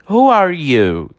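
slow, clear English model phrase; teacher modelling slowly for repetition; slow model sentence. Shown as a demonstration. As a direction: say it slowly so students can repeat. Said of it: "Repete a pronúncia comigo".

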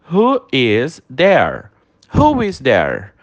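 slow, clear English model phrase; teacher modelling slowly for repetition; slow model sentence. Shown as a demonstration. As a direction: say it slowly so students can repeat. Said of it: "Repete a pronúncia comigo".

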